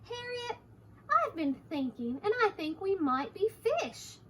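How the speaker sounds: lilting story-book character with high opening call; lightly voiced Hector. Hector the chicken tells Harriet his idea, lightly voiced. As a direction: share a new idea, pitch swinging up. Reads Hector's line to children.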